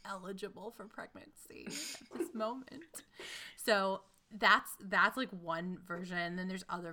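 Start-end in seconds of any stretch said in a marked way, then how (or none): 0.0-2.8 soft, breathy joke under the breath; conspiratorial whisper; whispered aside. Real, not acted. Joking aside about herself.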